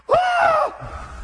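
very high sustained cry; high mock shriek; acted scream of pain. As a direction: scream as if just struck, acted. Acting out the arm being hacked.

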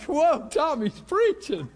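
high, loud, drawn-out whoa of astonishment; amazed exclamation. Retold or imitated. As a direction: marvel out loud, stretching it out. Amazed reaction to the fiery preaching.

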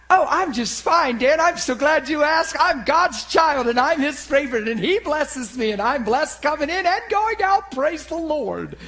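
high, loud mock-pious gushing; hammed-up blessed-and-fine act, high and insistent; over-cheerful believer. Preacher acting Trish hiding a headache, over-cheerful piety. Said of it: "And she says".

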